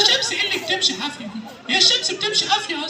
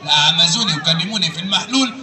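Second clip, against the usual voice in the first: very low-pitched puppet character; Aragoz puppet; 13 semitones lower than usual. Acted. Puppet explaining his visit to the host, Aragoz voice. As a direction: play a comic puppet, far below your own pitch. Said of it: "الأراجوز ده وهو بيرغي".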